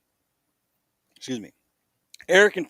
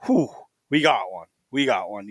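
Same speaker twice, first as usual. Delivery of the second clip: relief; 7 semitones lower than usual. Retold or imitated. When the Vermont bird hits the ground, low relief. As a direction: let the breath out and drop the pitch. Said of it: "it is a relief".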